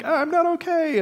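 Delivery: high mock wail of a child; child mimicking panic. Kid mimicking an alarmed parent, high mock wail. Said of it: "they, they mimic you".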